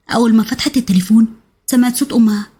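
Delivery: ordinary delivery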